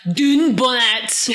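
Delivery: slow, overdone Scottish Dunbonnet; thick Scottish accent. Pretend. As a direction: ham up a terrible Scottish accent. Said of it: "incredibly thick and really terrible accent".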